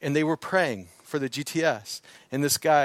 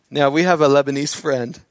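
normal talking voice

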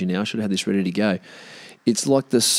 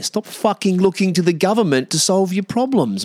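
exasperated rant, higher; 9 semitones higher than usual. Real, not acted. Ranting at government-dependent critics.

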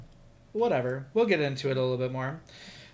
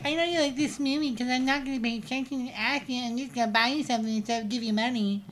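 higher mocking voice; mock gift giver; 9 semitones higher, faster than usual. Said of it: "And they were like".

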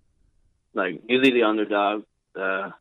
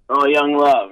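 louder, higher mock yell of a nickname; imitated yell; 5 semitones higher, 7 dB louder, slower than usual. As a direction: imitate someone yelling out a name. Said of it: "he used to yell".

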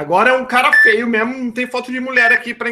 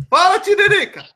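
louder and higher call; 9 semitones higher, 4 dB louder than usual. Real, not acted. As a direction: call out higher, pressing for an answer. Caller still not answering.

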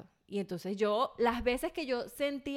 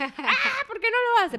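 high, loud laughing outburst of frustration; mock exasperation; 12 semitones higher, 8 dB louder than usual. Wife's inner thought about husband not helping, laughing high outburst. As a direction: let the inner rage burst out, laughing.

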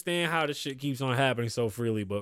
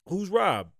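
ordinary talk

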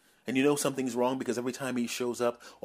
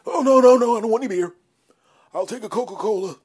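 very high, loud, frantic no; high eager refusal; 11 semitones higher, 10 dB louder than usual. Acted. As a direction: blurt out a panicked refusal. Friend eagerly refusing beer.

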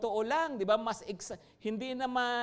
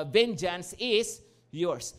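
slow prayerful quote; 3 semitones lower, much slower than usual. Acted. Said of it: "lagi mo iniisip, "Lord".

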